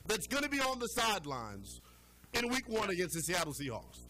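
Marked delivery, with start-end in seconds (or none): none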